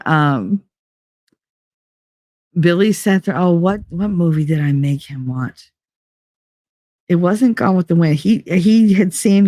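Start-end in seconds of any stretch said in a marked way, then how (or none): none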